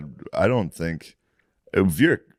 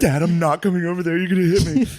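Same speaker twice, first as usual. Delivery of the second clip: put-on higher pleading kid voice; scared kid; 10 semitones higher, 4 dB louder than usual. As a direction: whine it up high like a frightened child. Scared kid refusing angry dad.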